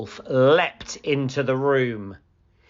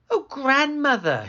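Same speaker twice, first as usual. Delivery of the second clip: put-on higher, wondering girl; curious little girl; 4 dB louder than usual. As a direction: marvel innocently.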